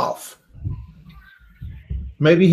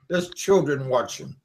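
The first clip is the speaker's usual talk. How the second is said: ordinary delivery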